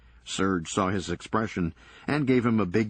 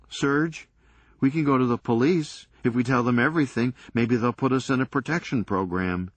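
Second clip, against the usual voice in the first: anxious pleading; 5 semitones higher, 4 dB louder than usual. Acted. Doug pleads to go to police.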